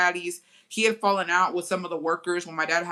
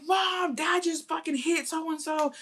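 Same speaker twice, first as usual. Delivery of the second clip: high, slow alarmed shout; calling to mom; 10 semitones higher, slower than usual. Retold or imitated. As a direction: yell for mom in a panic. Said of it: "I was like".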